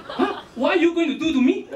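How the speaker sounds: high-pitched mock alarm; startled, high Singlish protest; shocked. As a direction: act out a shocked, rising question. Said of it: "She was shocked".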